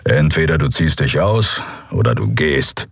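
tiefere, bestimmte Männerstimme; bestimmt. A narrator's reading. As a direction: klar und entschieden, etwas tiefer. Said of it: "stellte er klar". (tiefe, bestimmte Stimme)

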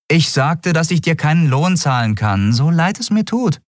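high-pitched captain's voice; woman captain repeating herself. Acted. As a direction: restate the terms in a high voice. Captain repeats her offer.

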